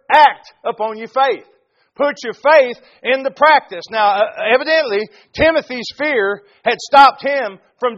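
loud, fervent sermon delivery; preaching at full force. Acted. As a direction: preach it hard to the congregation.

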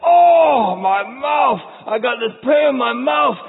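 high, loud cry of a patient with toothache; pained patient. Pretend. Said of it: "you're like".

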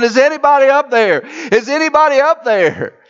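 high calling up from the bottom of a well; a man calling up a well. Acted. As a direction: shout upward for help. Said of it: "he kept calling".

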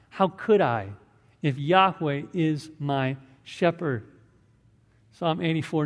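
ordinary talk

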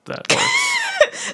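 sudden high-pitched yelp of approval; high squeal. Pretend. Sudden high burst, joke.

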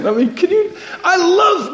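talking through a laugh. Real, not acted. Preacher delighted by the Bible's detail, laughing through it.

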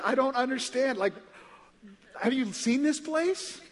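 high-pitched giddy wonder; awestruck bafflement. Acted. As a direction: marvel high and excited, totally baffled. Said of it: "shaking my head".

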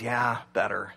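a hint of a calmed-down guy; lightly voiced guy. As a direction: answer low and settled, as the guy. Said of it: "the guy goes".

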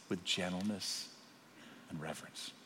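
softly, almost to himself; gentle hush. Shown as a demonstration. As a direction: say it gently, as the verse asks. Preacher shows gentleness while quoting.

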